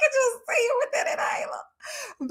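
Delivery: high, teary laughing words. Real, not acted. Host picturing the man with his inhaler, crying laughing. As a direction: squeeze it out through tears of laughter.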